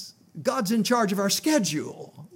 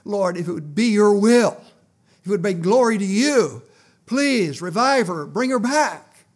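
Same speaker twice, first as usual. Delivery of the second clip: fervent prayer; 4 semitones higher, 10 dB louder than usual. Retold or imitated. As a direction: cry out to God to revive her, slowing down. Retold prayer over collapsed woman.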